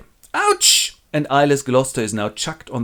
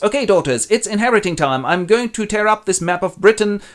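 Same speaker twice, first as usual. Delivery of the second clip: higher, louder royal proclamation; grand king voice for his daughters; hammed-up old king; 8 semitones higher, 4 dB louder than usual. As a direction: announce it like a pompous old king. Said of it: "and goes".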